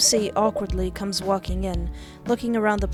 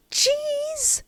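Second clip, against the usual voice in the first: shocked. Acted. After being slammed.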